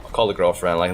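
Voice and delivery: usual speaking voice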